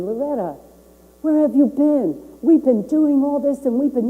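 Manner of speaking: high, loud mock yell of the angry man; imitated yelling. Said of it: "started screaming at me".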